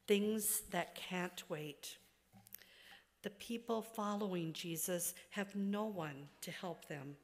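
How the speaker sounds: usual speaking voice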